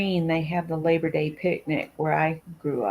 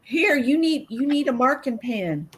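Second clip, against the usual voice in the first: put-on bossy old lady; 6 semitones higher than usual. Old ladies advising at bingo.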